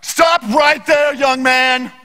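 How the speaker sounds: high, loud, slow stern call; pastor bursting in. Retold or imitated. Pastor halting a trial, loud stern slow call. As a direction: halt the room like a stern elder. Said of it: "was like".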